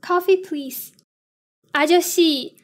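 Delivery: ordinary talk, nothing marked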